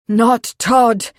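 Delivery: loud, disbelieving exclamation; thunderstruck roar. A narrator's reading. Edward questions the boy's news, thunderstruck. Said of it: "Edward roared, thunderstruck".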